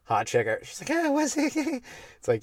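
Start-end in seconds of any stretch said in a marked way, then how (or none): 0.9-2.2 mock girly voice. Hot chick quoted.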